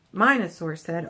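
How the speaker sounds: plain reading voice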